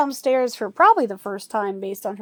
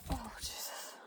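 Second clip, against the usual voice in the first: breathy whispered oath; 17 dB quieter than usual. Real, not acted. Dog stands on her face, whispered oath. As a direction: whisper it under your breath.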